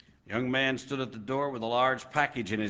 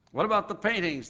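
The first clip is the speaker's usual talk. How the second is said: higher, indignant outcry; crowd crying out; 8 semitones higher, faster than usual. A narrator's reading. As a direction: call it out as the upset crowd. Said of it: "the people cried".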